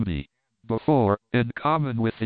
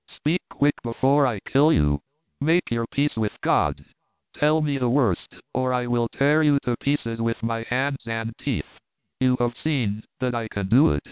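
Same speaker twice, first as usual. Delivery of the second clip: plain reading voice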